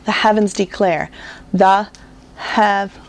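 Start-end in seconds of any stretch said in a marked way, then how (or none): 1.5-3.0 The, pause, hev-, broken off; The and hev- each said alone; syllable by syllable. Shown as a demonstration. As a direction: sound out The ... hev- as separate beats. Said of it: "I count with my fingers".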